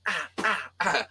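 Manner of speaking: high, hammed-up little yelps; mock pained yelps. Child being smacked by his mum, mock pained yelps. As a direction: yelp like a kid dodging mum's slaps. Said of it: "when your mum's hitting you".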